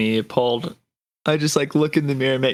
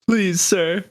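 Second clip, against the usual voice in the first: high, drawn-out plea; mock pleading; 6 semitones higher, slower than usual. Grandchild begs guard for help, hammed-up plea. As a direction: beg with hammed-up earnestness.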